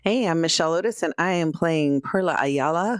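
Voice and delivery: normal talking voice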